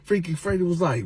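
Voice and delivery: ordinary talk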